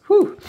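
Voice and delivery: sharp, high, loud exhale of relief; relieved whew. Real, not acted. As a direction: blow out the emotion in one breath. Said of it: "I didn't expect to get that emotional".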